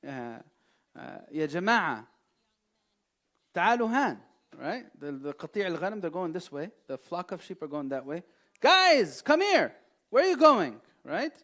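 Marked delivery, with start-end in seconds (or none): 1.3-4.2 mild mock call to a flock; calling out to sheep a touch louder; man calling sheep. Preacher mocks a man calling to sheep, lightly acted. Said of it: "This person is saying". 8.6-10.8 high, loud mock yell at a flock; yelling at sheep. Preacher acts a man yelling at sheep, high and loud. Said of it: "Imagine someone talks like that".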